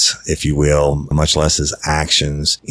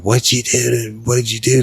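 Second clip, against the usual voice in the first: higher-pitched imitation of frantic questioning; retold freaking out; 8 semitones higher than usual. Girlfriend questioning him after checking on the baby, retold freak-out. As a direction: imitate someone freaking out, pitch raised, questions repeated urgently. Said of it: "she started freaking out".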